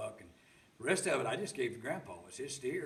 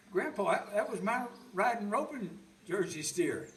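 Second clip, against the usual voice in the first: upset protest; 10 semitones higher, 7 dB louder than usual. Retold or imitated. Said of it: "Oh, I was so upset".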